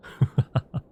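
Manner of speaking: short staccato villain laugh; dry "ha-ha-ha"; vampire chuckle. Acted. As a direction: cackle at your own dark joke. Vampire laughs at own dark joke.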